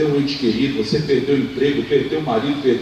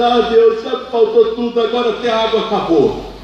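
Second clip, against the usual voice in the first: pleading lament; 7 semitones higher than usual. Acted. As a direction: raise the voice high in despair. Hagar pleading with God.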